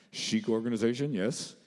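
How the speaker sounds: pretend assistant. Partner posing as his own assistant on the phone, officious. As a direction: put on an office assistant act. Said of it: "pretend like we had assistants".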